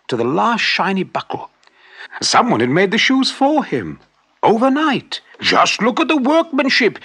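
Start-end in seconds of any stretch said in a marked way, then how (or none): 5.4-7.0 higher, louder, astonished; amazed exclaim. Acted. Shoemaker showing his wife the finished shoes, amazed exclaim. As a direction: marvel out loud at the shoes. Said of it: "he exclaimed".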